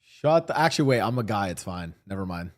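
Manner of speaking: usual speaking voice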